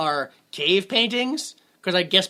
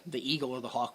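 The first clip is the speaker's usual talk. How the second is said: usual speaking voice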